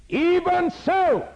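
high, slow, stretched shout; drawn-out preaching cry. Real, not acted. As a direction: hold it out long over the crowd.

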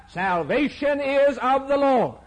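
high, ringing proclamation; exultant cry. Acted. Preacher voicing Jonah's cry of deliverance. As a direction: proclaim it like a rescued man. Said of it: "And I cried".

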